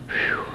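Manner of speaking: a short high breath of relief; high relieved whew. Retold or imitated. Boy relieved supper is only ready, high whew. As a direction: let the fear go in one breath. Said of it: "I was relieved".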